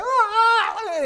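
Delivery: very high yell of alarm; imitated panic scream. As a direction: scream high as if fleeing a stampede. Retold scream amid trampling crowd.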